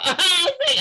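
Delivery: high, loud laughing burst; burst of laughter. Real, not acted. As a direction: break into real laughter. Laughing while retelling happy moment.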